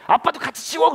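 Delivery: put-on high, loud child's retort; cheeky daughter. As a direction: order dad back, unabashed. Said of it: "제나가 저한테 갑자기 이러는 거예요". (당돌한 딸이 맞받아치듯)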